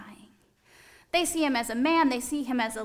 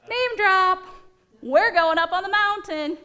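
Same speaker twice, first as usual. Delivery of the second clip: bragging hint; 7 semitones higher, 6 dB louder than usual. Acted. Disciple bragging about invitation.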